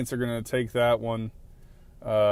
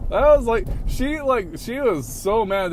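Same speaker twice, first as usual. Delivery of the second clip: much higher, louder, worked-up outburst; higher, louder disbelief about the honking lady; indignant; 10 semitones higher, 5 dB louder than usual. Real, not acted. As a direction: burst out in disbelief. Indignant about angry driver.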